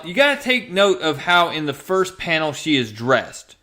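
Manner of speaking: usual speaking voice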